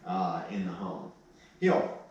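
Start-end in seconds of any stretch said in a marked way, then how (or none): 1.6-2.0 high, sharp heel; firm heel command. Real, not acted. Trainer to puppy on the leash, a firm high heel.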